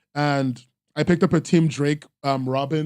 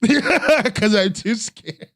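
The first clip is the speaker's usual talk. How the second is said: breaking into laughter mid-sentence, slow and higher; laughing; 6 semitones higher, much slower than usual. Real, not acted. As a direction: confess it through a real, self-mocking laugh. Laughing at own social anxiety.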